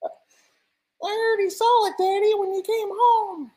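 put-on very high child's squeak; squeaky little kid, mock innocent. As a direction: pipe up like a tiny child. Small child answers the father.

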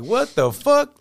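high, drawn-up mock shock; imitated astonishment. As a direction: play the stunned band as the star gets close. Band retold recognising the arriving star.

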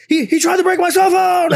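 very high-pitched retold wail; mock frantic protest. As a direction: cry out an excuse while fleeing. Retold excuse yelled while fleeing.

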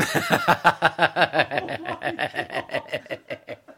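helpless running laughter; real laughing fit; long laugh. Real, not acted. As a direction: laugh on and on at the joke. Laughing at the pool joke.

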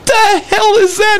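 high-pitched incredulous question; mock disbelief. Dealer confronts crackhead holding a duck, incredulous. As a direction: act out baffled disbelief.

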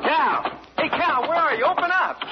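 high, urgent calls at the door; pounding on the door, calling his friend's name high and urgent; yelling for Cal. Retold or imitated. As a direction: shout for him through the door, pitch way up. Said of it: "I yelled".

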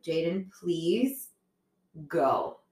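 drawn-out, slow request with a pause before the last word; measured parental plea, holding it in; restrained plea. Retold or imitated. Retold weary plea to daughter.

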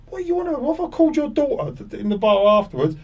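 high, mock challenging demand; hammed-up squaring-up voice; confrontational question. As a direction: corner him in the bar and ask outright. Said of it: "I said".

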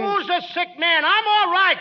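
ordinary delivery

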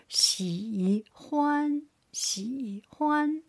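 喜欢 drawn out, 喜 dipping, 欢 high and level; third tone, first tone. Shown as a demonstration. Teacher showing the tone pair, 喜 dipping, 欢 high level. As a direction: dip 喜, then hold 欢 high. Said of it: "third tone with first tone".

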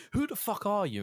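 mock outrage. Player confronting the stranger on the phone, mock outraged. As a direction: demand it in high-pitched pretend outrage.

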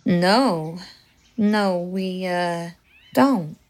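low, slow southern belle voice; southern accent. Acted. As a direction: drawl it like a southern lady. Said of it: "Her southern accent".

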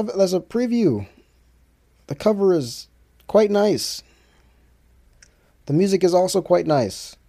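high, emphatic sales plug; hammed-up album promo voice; promotional announcer pitch. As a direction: sell it like an ad, pitched well up.

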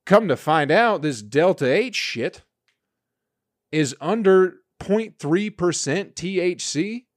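usual speaking voice

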